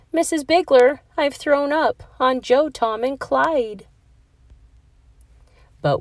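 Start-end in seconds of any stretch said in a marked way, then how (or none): 0.1-3.9 a lightly voiced upset child, a bit higher; a touch of a queasy kid's wail; mild crying child. A narrator's reading. Airsick angel calling to the teacher, mildly tearful. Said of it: "got airsick and cried".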